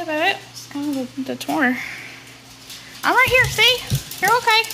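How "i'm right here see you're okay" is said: reassuring pet talk. Real, not acted. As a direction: soothe the pet in a high cooing voice.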